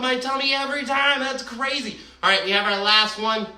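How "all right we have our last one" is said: ordinary delivery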